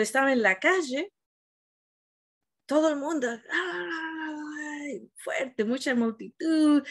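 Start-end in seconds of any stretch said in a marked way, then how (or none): 3.3-5.1 a short pitched-up cry of emotion; imitated crowd cry. Crowd in Seville crying out as the Virgin passes, sharp cry.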